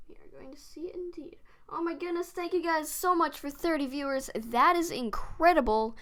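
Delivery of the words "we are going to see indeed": whispering. Real, not acted. Muttering aside while drawing.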